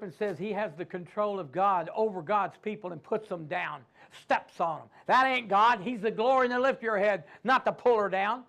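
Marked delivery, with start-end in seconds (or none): none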